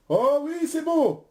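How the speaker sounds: very high, jubilant approval; delighted confirmation. Real, not acted. Quiz host approving a contestant's answer, delighted. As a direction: leap up in pitch with delight.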